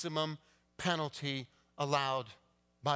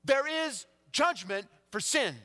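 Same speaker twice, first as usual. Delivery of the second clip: fervent preaching; 12 semitones higher, 6 dB louder than usual. Acted. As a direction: drive the warning home. Preaching surges again.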